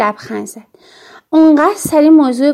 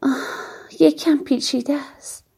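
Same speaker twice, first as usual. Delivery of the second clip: weary sigh, then softer words; worn-out mother, softly evasive; tired voice; 7 dB quieter than usual. A narrator's reading. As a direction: sigh and let the energy drop. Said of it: "مادر با صدای خسته گفت".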